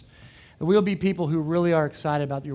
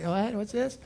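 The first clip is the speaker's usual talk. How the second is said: soft, puzzled and higher; a half-awake confused question; mild mock bewilderment; groggy surprise; 5 semitones higher, 6 dB quieter than usual. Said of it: "Before I was like".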